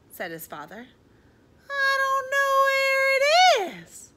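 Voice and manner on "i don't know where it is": put-on childish wail. A narrator's reading. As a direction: wail it out, stretching the last words. Froggy wailing back to his father.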